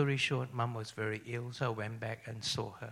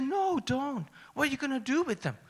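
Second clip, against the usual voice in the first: much higher, louder, fast protest; alarmed mother; 13 semitones higher, 5 dB louder than usual. Retold or imitated. Mother begs son not to take her idols, high panicked protest. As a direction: squeak out an old mother's panicked no.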